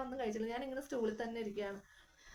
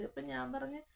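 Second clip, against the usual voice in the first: ordinary talk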